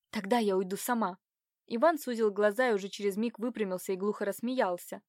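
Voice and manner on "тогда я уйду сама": ordinary delivery, nothing marked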